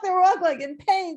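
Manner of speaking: plain reading voice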